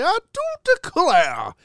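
very high, genteel Southern exclamation; hammed-up Louisiana "I do declare"; mock Southern accent. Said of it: "walk into the farmer's market and say".

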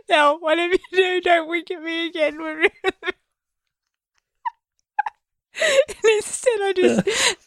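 very high, squeaky with real laughter; helpless laughter. Real, not acted. Co-host recalls being told not to wink, laughing helplessly. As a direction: barely get it out through weeping laughter.

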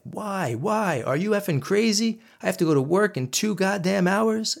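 husband screaming at his wife, furious yell; retold screaming. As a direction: scream it like you've lost it. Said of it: "I started yelling something like".

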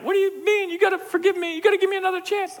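high, fast, whiny pleading ex; mock crying girlfriend. Pastor imitating the crying college girl pleading on the phone. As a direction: beg him for another chance, high and mock-tearful. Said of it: "And she starts crying".

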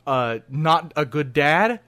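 ordinary talk, nothing marked

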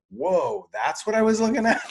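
high, fast, stunned exclamation; surprised gasp. Retold or imitated. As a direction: blurt it out, taken aback. Retelling own reaction to scene.

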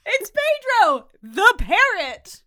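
high, loud, drawn-out announcement; mock dramatic reveal. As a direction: announce the absurd star slowly and loudly.

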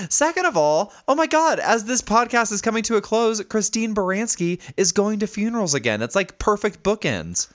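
very high, thrilled exclaiming; real excited amazement. Real, not acted. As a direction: marvel at the coincidence, pitch way up. Amazed at funeral coincidence.

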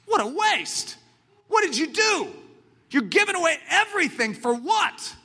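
high scoffing voice of the crowd; mocking onlookers. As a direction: scoff like a shocked bystander. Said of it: "what's everyone gonna say around you?".

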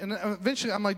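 normal talking voice

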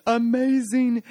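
lightly hammed-up delight; proclaiming. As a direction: sell it like a big announcement.

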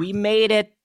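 half-joking celebration; mock cheer. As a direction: chirp it like a tiny toast. Echoing the producers' line, mock cheer.

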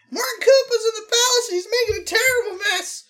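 high, loud mushroom squeak; high squeaky Toad voice. Acted. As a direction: squeak the alarm like a tiny retainer.

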